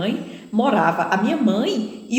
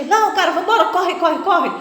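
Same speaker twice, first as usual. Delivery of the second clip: high, fast urgent orders; hurried robber; 8 semitones higher, 3 dB louder than usual. Retold or imitated. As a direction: rush the partner out. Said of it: "e o outro". (bandido apressado, rápido e agudo)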